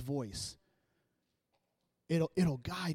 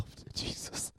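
scared whisper; 10 dB quieter, slower than usual. Retold or imitated. Scared wife in labor.

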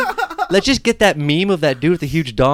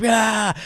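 mock yell; 10 semitones higher than usual. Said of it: "he's like".

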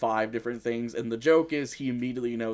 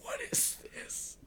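slow unvoiced whisper; 12 dB quieter than usual. Real, not acted. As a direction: whisper it slowly, baffled. Baffled by a word.

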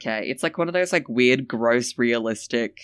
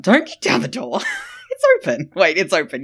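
laughing protest; 5 semitones higher, 8 dB louder than usual. Real, not acted.

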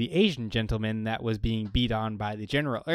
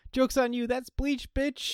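high-pitched mock taunt; gloating prankster; 12 semitones higher, slower than usual. Host revealing a pretend prank, high gloating taunt. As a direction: crow it at your fooled victim. Acting out own cruel prank.

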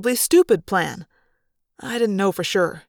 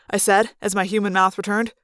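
ordinary delivery, nothing marked

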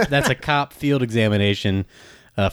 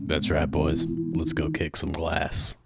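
low, softer action-hero delivery; low, unhurried tough talk before a fight; tough-guy rallying; 8 semitones lower, 6 dB quieter than usual. Acted. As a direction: drop the voice low and rally the boys. Player voices character rallying the group.

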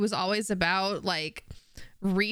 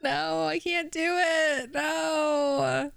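anguished cry; 7 semitones higher, slower than usual. Real, not acted. Overcome by the story's ending.

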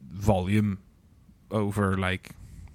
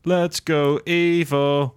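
cheering; 6 semitones higher, 5 dB louder, much slower than usual. Real, not acted. Cheering on a favourite fighter.

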